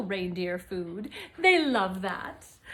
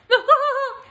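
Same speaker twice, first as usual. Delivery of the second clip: loud amused burst of laughter; high bright laugh; 13 semitones higher, 9 dB louder than usual. Real, not acted. Reader laughs aloud at the gift tag to Pete.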